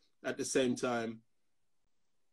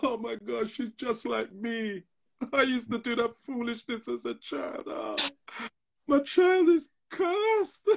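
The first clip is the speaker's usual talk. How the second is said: retold heartbreak, pitched way up and drawn out; tearful dismay; 11 semitones higher than usual. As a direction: let the voice rise and crack on the last words. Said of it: "tears came into my eyes".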